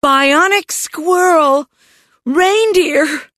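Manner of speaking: high-pitched alarmed bursts; frantic little girl blurting; excited spouting. A narrator's reading. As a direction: spout each guess as a high cry. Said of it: "Judy spouted".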